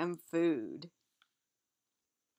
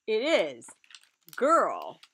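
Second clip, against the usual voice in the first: ordinary delivery, nothing marked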